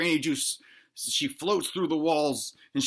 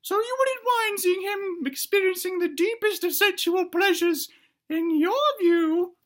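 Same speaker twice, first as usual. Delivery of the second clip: very high put-on granny voice; probing granny. Granny tests the wife's claim not to care, probing. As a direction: falsetto granny laying a trap, slowing at the end.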